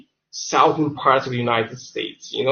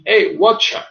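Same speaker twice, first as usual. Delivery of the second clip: Cockney greeting; 5 semitones higher than usual. Shown as a demonstration. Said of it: "you should try and imitate accent".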